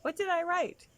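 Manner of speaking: put-on high, eager child asking; little girl voice. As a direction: chirp it like a proud five-year-old. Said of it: "she would say".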